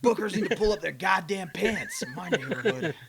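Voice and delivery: loud, hammed-up griping; mock neighbor rant. Neighbor griping about sagging pants, mock rant.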